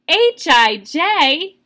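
loud, high, punchy letters; excited teacher reading letters out big; excited exclamation. Shown as a demonstration. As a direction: shout the letters with exclamation marks. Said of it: "here's a exclamation mark".

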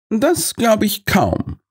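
high, slow, soft-spoken detective; quiet Holmes. A narrator's reading. Holmes murmurs his doubt, high and slow. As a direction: let the doubt drift out slowly. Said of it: "meldete sich Holmes leise".

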